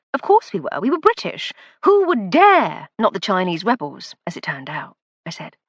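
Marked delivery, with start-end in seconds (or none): 0.1-2.9 mock-confident colonial bravado growing louder; ironic bravado. A narrator's reading.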